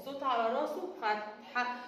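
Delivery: normal talking voice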